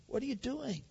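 quiet voice of God. Pretend. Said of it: "God is saying".

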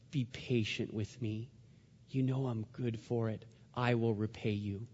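low, soft acted plea; hushed begging. Debtor begging his fellow servant for patience, hushed pleading. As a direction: beg quietly, voice dropped right down. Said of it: "he pleaded and he begged".

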